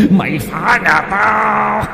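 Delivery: very high, slow ghost taunt. A narrator's reading. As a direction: drag the words out eerily. (giọng ma chậm, cao, rợn người)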